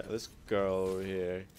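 ordinary talk, nothing marked

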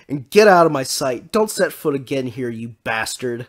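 loud, furious dismissal easing off at the end; scolding woman's shout; angry scream. A narrator's reading. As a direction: yell them off the doorstep. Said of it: "the blonde woman screamed".

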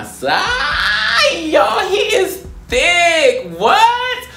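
high, loud, drawn-out squeal; shrieking with delight, slowed right down; high hyped shout; excited shriek. Real, not acted.

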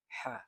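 হা said thin and light, breathy from the throat. Shown as a demonstration. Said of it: "এটা একটু চিকন করে উচ্চারণ হবে". (হা চিকন করে উচ্চারণ)

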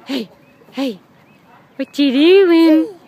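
drawn out and loud; slow loud sing-song. Real, not acted. Talking to toddler at the park.

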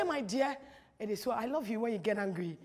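low, soft loving reassurance; tender soothing. Acted. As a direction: gently sweet-talk an angry partner. Models soothing an angry woman.

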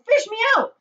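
high, loud distressed yell; yelling for rescue. A narrator's reading. Drowning boy calls from chocolate river, yelling. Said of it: "he yelled".

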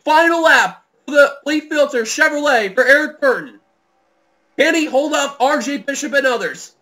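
rising to a loud, high final-lap call; building excitement. Real, not acted.

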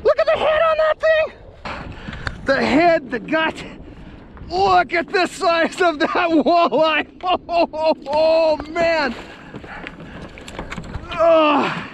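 high-pitched, gushing amazement; speechless excitement. Real, not acted. Angler showing off a huge walleye's size, overcome. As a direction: dissolve into laughing, wordless oh's. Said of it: "I can't even talk".

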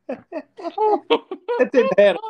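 high, slow words through laughter; real helpless laughter; laughing. Real, not acted. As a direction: squeeze it out mid-laugh. Laughing hard.

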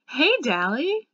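loud, slow, high call of his name; a glad, drawn-out loud hello; excited greeting. Acted. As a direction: shout it out, thrilled to see him. Waking to find Dally there.

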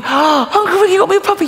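put-on very high, squealing child voice; excited little girl. Niece greeting her uncle about the puppy, high excited child voice.

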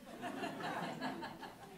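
soft, high breathy laugh; quiet laugh at one's own joke; amused chuckle. Real, not acted. Diarist amused by the film festival idea, soft chuckle.

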